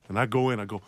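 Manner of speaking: normal talking voice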